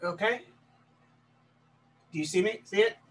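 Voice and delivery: ordinary talk, nothing marked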